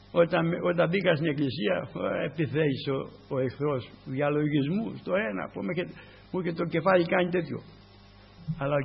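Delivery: ordinary talk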